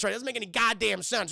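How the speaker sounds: ordinary delivery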